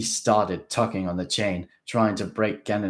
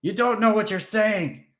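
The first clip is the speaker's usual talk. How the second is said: hissed; 13 semitones higher, 7 dB louder than usual. A narrator's reading. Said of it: "Haas hissed".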